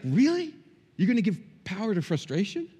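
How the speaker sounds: high, rising disbelieving question; acted-out incredulous challenge; mock incredulous. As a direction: question it as if you can't believe it. Imagined divine rebuke, incredulous question.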